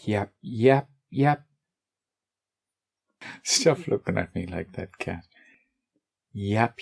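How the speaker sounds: yap, yap tossed off like chatter; playful yap yap babble; mock yapping. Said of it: "I would enjoy talking like that".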